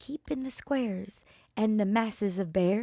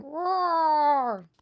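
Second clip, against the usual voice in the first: higher, louder playful lion roar; 7 semitones higher, 4 dB louder than usual. A narrator's reading. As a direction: roar it out for the child. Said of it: "Leonard started roaring".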